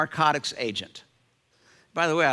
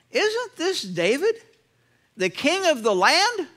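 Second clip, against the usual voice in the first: lightly acted surprise, slower and higher; astonished questioning; 9 semitones higher than usual. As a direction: read it as servants startled to recognise him. Servants recognise David, acted questioning.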